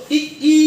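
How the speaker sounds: ই drawn out as a long ee; ই stretched, the long ee sound; long ee vowel demo. Shown as a demonstration. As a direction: hold the ই vowel. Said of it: "long ই sound".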